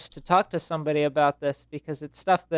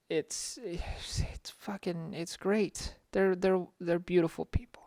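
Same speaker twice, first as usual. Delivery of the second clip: whispering; 11 dB quieter, slower than usual. Acted. Joking hushed aside about friends.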